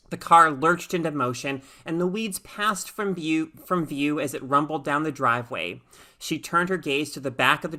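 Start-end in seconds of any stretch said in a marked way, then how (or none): none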